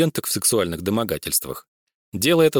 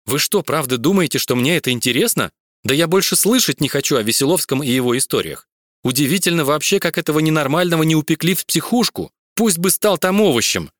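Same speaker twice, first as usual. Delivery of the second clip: rising, louder irritated rant; irritated outburst; 6 semitones higher, 3 dB louder than usual. Acted. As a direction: snap at him in annoyance. Vika rants angrily.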